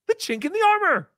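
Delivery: high-pitched mock grandmother; high impression of a grandmother's saying; imitating grandmother. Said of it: "That's what she would say".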